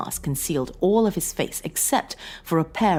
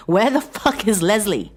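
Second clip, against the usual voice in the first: louder, higher furious demand; angry demand; 8 semitones higher, 7 dB louder than usual. A narrator's reading. John to his wife, furious demand. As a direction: spit out the question at her.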